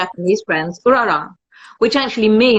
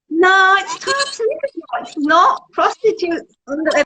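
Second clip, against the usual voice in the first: mock high retort; 9 semitones higher than usual. Her own retort, mock high.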